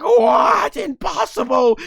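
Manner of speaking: high, loud disbelieving yell; shocked villain shout. Retold or imitated. Goma, stunned that Goku survives, yelling in shock. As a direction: cry out in disbelief.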